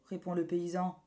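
usual speaking voice

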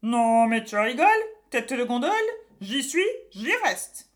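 loud mocking witch voice; a stubborn witch taunting the boy; sneaky cartoon thief. A narrator's reading. Witch refusing to leave.

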